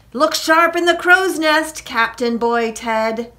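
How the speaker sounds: high, loud commanding call; captain's orders. A narrator's reading. Jack sends Casper to the cannons, commanding call. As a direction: call orders across the deck.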